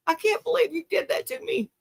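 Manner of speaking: high, whiny mock outrage in the husband's voice; mock betrayed husband. Husband over the football game, in a high mock-whiny protest. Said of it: "he was like".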